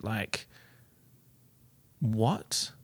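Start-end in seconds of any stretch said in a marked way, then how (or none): none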